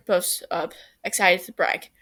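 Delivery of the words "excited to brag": plain reading voice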